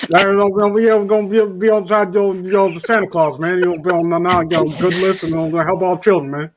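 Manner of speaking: rambling, mumbled run-on impression; Boomhauer. Cartoon mumbler pledges help for Santa Claus, comic rambling. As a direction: slur the words into one rolling mumble. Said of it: "Yeah, like Boomhauer".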